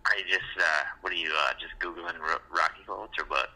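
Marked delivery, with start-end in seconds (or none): none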